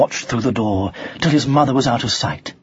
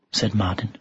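usual speaking voice

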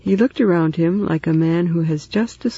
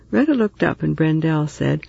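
plain reading voice

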